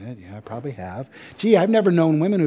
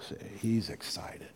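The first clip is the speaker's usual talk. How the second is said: whisper; 5 semitones lower, 12 dB quieter than usual. Real, not acted. Aside to congregation.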